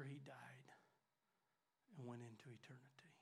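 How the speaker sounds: whispered, voice dropped away; grieving whisper. Real, not acted. Pastor recalling his mentor's death, hushed whisper. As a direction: let the words fall to a bare whisper.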